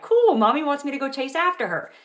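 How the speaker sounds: put-on thrilled little kid about to chase mom; excited toddler. Toddler thrilled his mom wants a chase, excited. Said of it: "he gets real excited".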